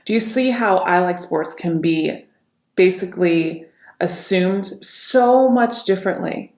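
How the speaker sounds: normal talking voice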